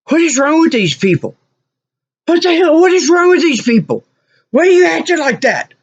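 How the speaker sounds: high, exasperated tirade; outraged. Retold or imitated. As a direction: tell them off in disbelief, voice high. Outraged customer telling them off.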